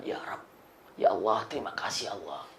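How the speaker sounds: soft, low plea to God; hushed grateful prayer. Retold or imitated. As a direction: murmur thanks as if through tears. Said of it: "Dijawab mengatakan". (doa syukur lirih, nada rendah)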